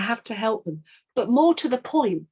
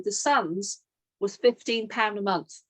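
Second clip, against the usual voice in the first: normal talking voice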